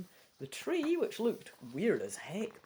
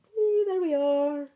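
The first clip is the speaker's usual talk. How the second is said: much higher, cooing and delighted; gushing delight; 8 semitones higher, 5 dB louder than usual. Real, not acted. Player cooing over the campfire picture, delighted. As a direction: gush over how lovely it is.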